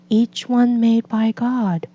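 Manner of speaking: ordinary delivery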